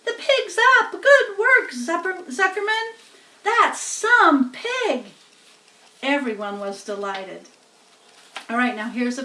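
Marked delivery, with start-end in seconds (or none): 0.0-5.0 much higher, loud excited yells; crowd cheering. A narrator's reading. Crowd cheers the revived pig, excited yelling. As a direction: whoop for the pig. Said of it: "yelled the crowd".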